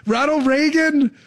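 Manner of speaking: high, drawn-out echo of the name; mock incredulity. Feigned surprise at the name.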